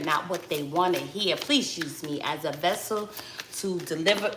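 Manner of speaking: low, soft prayer to the ancestors; quiet prayer. Acted. Asking Allah and her ancestors for guidance, soft low prayer. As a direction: pray quietly for guidance, head bowed.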